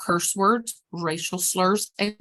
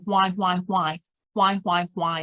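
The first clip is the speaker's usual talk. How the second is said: ordinary delivery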